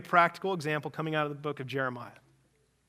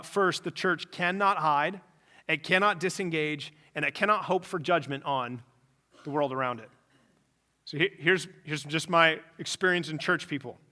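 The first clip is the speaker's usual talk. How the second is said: ordinary talk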